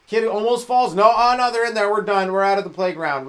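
high, loud alarmed parent voice; panicked helicopter parent. Pretend. Overprotective parent yanking kid off the playground, panicked shout. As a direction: shriek like the kid nearly died.

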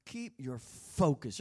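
"Keep" stressed, slow and weighty; slow down and press on "Keep". Shown as a demonstration. Preacher urging the church: slow, stressed "Keep". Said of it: "the apostle is saying".

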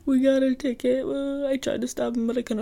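high, tearful retold sob over a lost ticket; crying to dad. Phoning her dad in the building, tearfully high and broken. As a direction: choke it out as if through tears. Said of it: "And so I go and I start crying".

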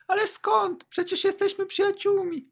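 high, light falsetto female character; Kasia's voice. A narrator's reading. Kasia reassuring her shy friend, high falsetto. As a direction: put on a high woman's voice. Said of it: "uśmiechnęła się".

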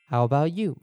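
ordinary delivery

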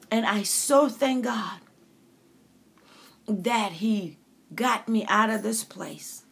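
ordinary delivery

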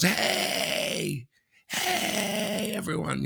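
mock yell to a crowd far below; imitated yell. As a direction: call out like the man on the bridge. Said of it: "yells out to these".

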